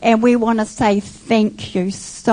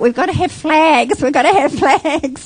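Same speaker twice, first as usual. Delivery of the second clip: eager thought; 5 semitones higher than usual. Retold or imitated.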